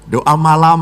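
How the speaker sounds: usual speaking voice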